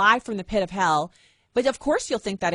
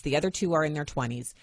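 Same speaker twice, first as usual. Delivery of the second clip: ordinary talk, nothing marked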